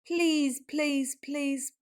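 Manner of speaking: usual speaking voice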